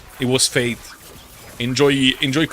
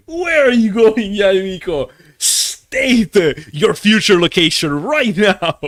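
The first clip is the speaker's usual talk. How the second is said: mock demand; 6 semitones higher, 6 dB louder, slower than usual. Mock-demanding the character's whereabouts.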